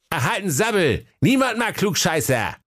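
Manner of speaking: high, brushing-off retort; dismissive Wilhelm. Acted. Wilhelm waves off his know-it-all brother, high and dismissive. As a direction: wave the smart-aleck away.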